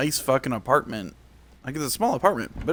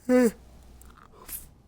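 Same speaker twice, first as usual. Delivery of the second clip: long yawn; 13 semitones higher than usual. Real, not acted. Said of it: "I'm so sorry for all the yawning".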